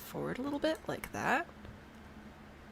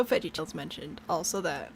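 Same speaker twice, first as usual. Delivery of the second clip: ordinary talk, nothing marked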